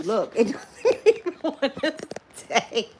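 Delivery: words breaking up into real laughs; laughing complaint. Real, not acted. Complaining about the phone not staying, laughing. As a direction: talk through rising laughter.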